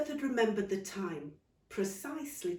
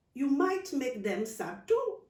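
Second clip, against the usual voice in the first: gentle advice; 6 semitones higher, 4 dB louder, slower than usual. Acted.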